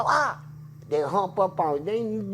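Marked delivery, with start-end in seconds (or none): 0.0-0.4 sudden high yelp, like a rat just popped up; startled yelp. Retold or imitated. Inmate retelling a rat leaping out of the toilet, high yelp. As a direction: jump back with a sharp "Ah!". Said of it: "and you go".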